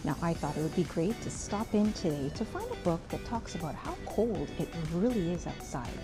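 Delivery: ordinary delivery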